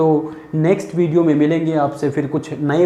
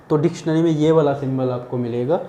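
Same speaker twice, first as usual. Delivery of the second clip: normal talking voice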